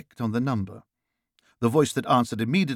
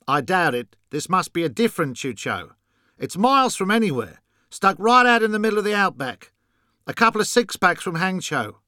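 higher, scoffing Australian caller; Australian accent; 7 semitones higher, 3 dB louder than usual. A narrator's reading. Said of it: "noticing the Australian accent".

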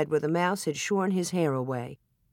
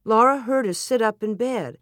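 plain reading voice

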